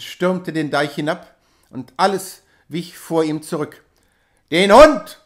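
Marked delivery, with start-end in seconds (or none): 4.5-5.2 very loud high demand; furious shout. A narrator's reading. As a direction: yell down the slope. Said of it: "schrie er". (wütender Schrei)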